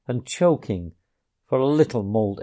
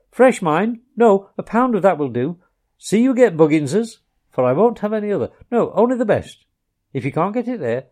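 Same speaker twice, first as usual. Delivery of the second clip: higher, louder muttered instructions; Rat's orders; 8 semitones higher, 6 dB louder than usual. A narrator's reading. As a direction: rattle off a shopping list. Rat's overheard shopping orders.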